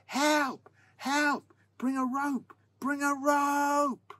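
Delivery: high, loud panicked cries, last word stretched long; screaming for help. A narrator's reading. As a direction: scream desperately from the bottom of a ditch. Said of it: "So he started screaming".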